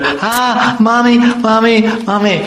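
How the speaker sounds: high, whimpering cries for mommy; terrified cry. Acted. Madhumangal, scared of the horse demon, high terrified wail. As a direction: wail for your mother like a scared little boy. Frightened boy cries for mother.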